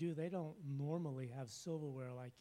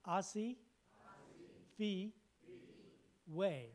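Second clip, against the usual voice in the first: Asi Fi Way split into separate syllables; 3 semitones higher, slower than usual. Shown as a demonstration. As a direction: break it up slowly, one syllable at a time. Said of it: "I did it so you would understand".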